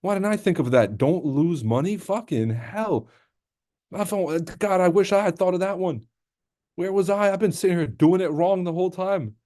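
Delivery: sarcastic mock wonder at an obvious tip; sarcastic awe. Real, not acted. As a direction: gush with fake admiration.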